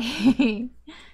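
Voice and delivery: bright burst of laughter; laughing. Real, not acted. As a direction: laugh at your own impression. Laughs after mock outburst.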